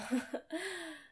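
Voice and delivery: quick high laugh; a short burst of laughter. Real, not acted. Laughs at own remark.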